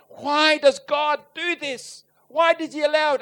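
a puzzled churchgoer begging an answer; pleading questioner. Retold or imitated. People questioning him.

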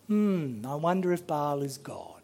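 soft unvoiced whisper, half to yourself; hushed wondering whisper; whispered musing. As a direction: breathe it like a dawning private thought. Penny dropping, muttered thought.

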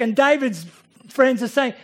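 ordinary talk, nothing marked

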